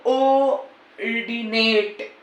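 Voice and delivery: ऑर्डिनेट stretched very slowly; drawn-out pronunciation. Shown as a demonstration. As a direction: drag out every sound of ऑर्डिनेट. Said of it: "अब इसका actual pronunciation जो होता है". (ऑर्डिनेट को बहुत खींचकर बोलना)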